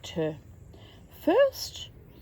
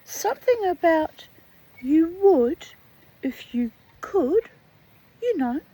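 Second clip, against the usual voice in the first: little voice; 7 semitones higher, 5 dB louder than usual. A narrator's reading. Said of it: "said the little voice close to her ear".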